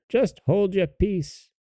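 high, slow witch scolding; old hag. A narrator's reading. Old hag hushing the sobbing girl, high and slow. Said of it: "said the old hag".

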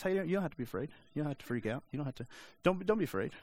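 calm her down gently, a bit silly; lightly mocking soothing angel; mock reassuring.

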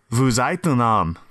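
slow, drawn-out Quebecois French line; Quebecois French accent. Retold or imitated. Imitating Kaya's Quebecois French for the friends. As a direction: imitate how Kaya says it in Quebecois French. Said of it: "she would say".

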